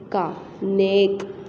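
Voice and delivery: नेक said slowly with a heavy, long ए; heavy ए sound. Shown as a demonstration. Teacher to pupils, slow heavy ए in नेक. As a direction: draw out the ए in नेक. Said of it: "भारी sound में पढ़ा".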